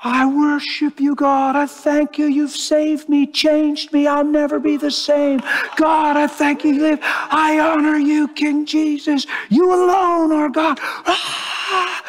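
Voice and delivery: high-pitched, sustained cry of praise to God; screamed worship. Retold or imitated. Man alone at home worshipping God, screaming like a madman. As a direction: re-enact fervent prayer at the top of the range, ending in a scream. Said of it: "screaming at the top of my lungs".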